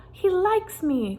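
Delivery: high, slow, delighted cry of joy; a gentle giant thrilled the kitten likes him; joyful cry. Acted. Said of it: "Quasimodo cried".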